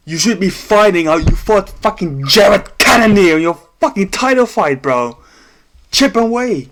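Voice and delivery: frustrated rant. Real, not acted. Streamer ranting at Adesanya, exasperated. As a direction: vent the frustration hard.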